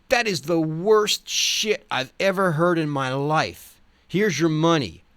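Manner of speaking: imitating club owner. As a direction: sneer it like a disgusted club owner. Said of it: "Then he said".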